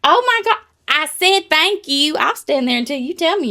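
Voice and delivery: worked-up disbelief; exasperated. Retold or imitated. About a cashier who won't say you're welcome, exasperated. Outraged retelling checkout snub.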